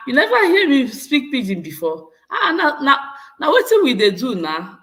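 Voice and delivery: laughing, amused protest; playful excited. Real, not acted. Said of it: "it's funny hearing you speak Pidgin".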